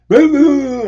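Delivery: high, loud drawn-out whoop; mocking wave-off noise. Retelling how she brushed the friend off, loud mocking whoop. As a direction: toss out a shrill-pitched dismissive "boo".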